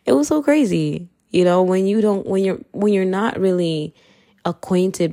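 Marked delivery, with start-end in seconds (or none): 0.1-1.1 higher, laughing disbelief. Shown as a demonstration. Laughing at her past self, high and amazed. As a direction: pitch jumps high on "crazy".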